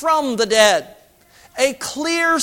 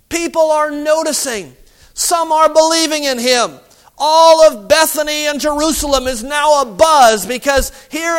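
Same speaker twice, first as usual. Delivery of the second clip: rising, louder preacher's build-up; preaching at full force; 4 semitones higher, 4 dB louder than usual. Acted. Preacher stirring up the congregation about the Sanhedrin, fervent preaching. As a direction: drive it home to the congregation, louder.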